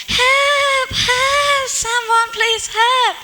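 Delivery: high, desperate calls for help; high-pitched panicked plea; cry for help. Acted. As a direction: shout for help like you're drowning. Ant swept into river.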